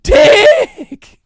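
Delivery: high shouted outburst; mock yell. Ranting podcaster yells at the clock. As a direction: blurt it out in a sudden high yell.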